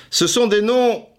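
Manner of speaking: high, loud hammed-up proclamation; high pompous announcer voice, ending in howls; grand mock build-up. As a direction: proclaim it like an epic legend.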